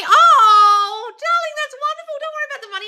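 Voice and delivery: very high, sing-song soothing voice; high honeyed comfort; mock sugary reassurance. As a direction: coo it like a saccharine fairy godmother. Sweet mock-soothing reply.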